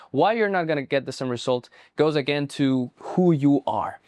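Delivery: ordinary talk, nothing marked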